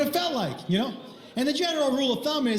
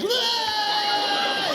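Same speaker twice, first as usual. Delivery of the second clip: high, held comic scream; mock scream; 9 semitones higher than usual. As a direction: scream as if blown back. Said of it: "his hair was like".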